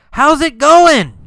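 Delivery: high, loud shouted greeting; yelled. Acted. Carlos calling again to the girl, yelled. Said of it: "Carlos yelled again".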